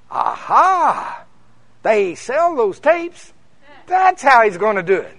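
preacher thinks he has cracked it; eureka. Retold or imitated. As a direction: savour figuring out the plan. Scheming thought, sees a plan.

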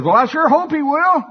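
high, loud shouted reply; calling back. Acted. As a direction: holler it back across the street. Man calls back across street.

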